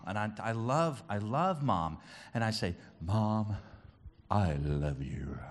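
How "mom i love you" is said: low, soft, slow and choked-back; imitated tender confession. Son telling Mom he loves her, imitated tender confession. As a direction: say it slowly, imitating someone near tears. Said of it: "when I'm honest".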